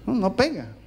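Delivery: disapproving wife. Acted. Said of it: "Mi esposa me dice".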